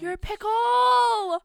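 slow, high, loud taunt; teasing shout. Real, not acted. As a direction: drag out the taunt.

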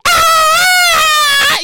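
high loud excited yell; radio hype scream. Pretend. Host pictures himself on the radio, loud high hype scream. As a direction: shout it like a hyped radio host. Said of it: "I'm on your local radio like".

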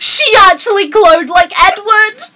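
very high, loud excited shriek. Real, not acted. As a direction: shriek it with glee. Excited shout at the screen.

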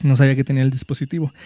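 usual speaking voice

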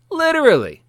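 sudden high, loud burst on Literally; emphatic shout. Real, not acted. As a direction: blurt it out, pitch jumping way up. Emphatic outburst on hockey.

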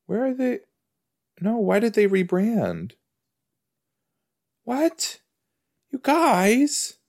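high-pitched dismayed whine; high, incredulous lament; dismayed disbelief. Real, not acted. Dismayed that power bars were rebranded.

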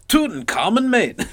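high, hammed-up brogue that slips Scottish; Irish accent. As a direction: ham up a bad Irish accent. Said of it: "that sounded Scottish".

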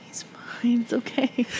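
hushed whisper, unvoiced; soft whisper meant to reassure. Real, not acted. Whispering over the baby.

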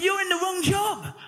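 incredulous inner thought. Retold or imitated. Preacher's inner thought about a clock-watching teacher, incredulous. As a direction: think it in disbelief at the bored teacher. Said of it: "I'm thinking".

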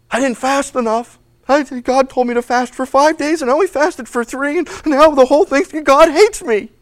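mock fretting. Believer confessing a failed fast, mock distressed. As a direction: fret like a guilty believer.

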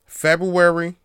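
FEBRUARY said high and loud like a big reveal; February, announced loud. Shown as a demonstration. Announcing the book release to podcast listeners, loud. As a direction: belt out February as a teaser. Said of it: "No, no, that's too specific".

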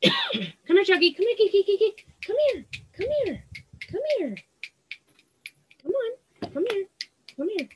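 very high coaxing pet voice; cat-calling voice. Real, not acted. Owner calling her cats to come to her, high and coaxing. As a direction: coax the kitties over in a high sing-song.

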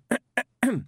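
high, affected little throat-clear; prim attention-getting ahem. Acted. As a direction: clear your throat pointedly in a hostess voice. Hostess awkwardly clears her throat.

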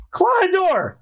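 high, scolding name-call; very high indignant exclamation; outraged cry. Acted. Mistress cries out in outrage.

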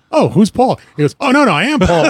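hammed-up flustered father; flustered dad. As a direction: fumble like a man caught out. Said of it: "You're not even gonna play the character correctly".

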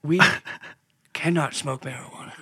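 halting presidential impression; measured speechmaking with pauses; Ronald Reagan. Imitating a press conference, halting president. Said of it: "in Ronald Reagan's fucking just voice".

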